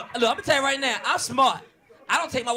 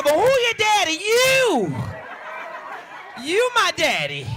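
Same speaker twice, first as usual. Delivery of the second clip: much higher, louder squealing submission; mock squeal; 12 semitones higher, 6 dB louder, much slower than usual. Skydiver answering the instructor, high squealing surrender.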